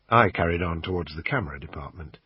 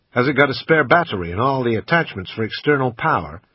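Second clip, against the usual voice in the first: put-on a louder American accent, kept up while haggling; bad American accent; 4 semitones higher, 5 dB louder than usual. Brit quizzing the shop assistant, bad American accent. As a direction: keep the clumsy American put-on going. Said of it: "in my usual bad American accent".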